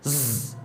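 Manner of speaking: very soft, low "ز" buzz; a barely voiced "ز"; faint whistle demo. Shown as a demonstration. Teacher finishing the whistle comparison, faint buzz. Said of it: "اور اس کی".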